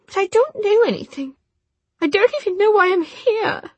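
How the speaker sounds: high, loud frantic protest; distressed young woman. Acted. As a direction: cry out in indignant panic. Jessamine protesting, voice shrill.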